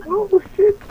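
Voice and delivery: panicked high yell; screaming while falling. Real, not acted. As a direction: yell as you drop. Falling far down.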